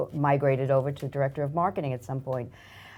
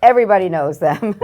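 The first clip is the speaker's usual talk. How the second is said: laughing; 5 semitones higher, 9 dB louder than usual. Real, not acted. Guest laughs, everyone knows them.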